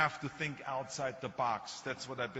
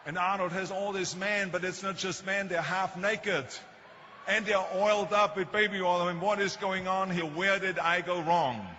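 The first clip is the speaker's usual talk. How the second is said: imitating a mother's dismay, building to loud, higher exasperation; mock maternal outcry that mounts to a shout; distraught mother; 3 semitones higher, 4 dB louder than usual. Mother complains to doctor about son's pictures, mock exasperated. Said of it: "tears started running down her eyes".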